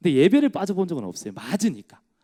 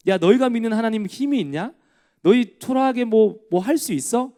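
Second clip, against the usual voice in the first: Goliath taunting; 6 semitones higher than usual. Acted. Goliath jeering at Israel's God, mocking taunt. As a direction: sneer at Israel like a boastful giant. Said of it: "욕하고 있어요".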